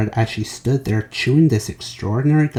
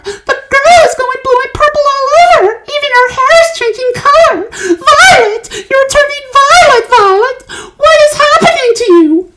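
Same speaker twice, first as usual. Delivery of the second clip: very high, loud, crying panic; hysterical mother; 10 dB louder than usual. A narrator's reading. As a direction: wail in horror at your child. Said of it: "yelled Missus Beauregard".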